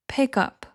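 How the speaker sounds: "Pick up" run together as one word; "Pick up" linked, end stressed. Shown as a demonstration. Teacher models connected Pick up for learners. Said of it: "it could sound like this".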